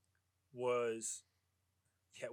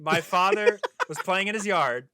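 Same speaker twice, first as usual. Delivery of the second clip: louder and slower, talking over others; 5 semitones higher, 10 dB louder than usual. Real, not acted. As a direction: raise your voice to take the floor back. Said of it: "wait, let me finish".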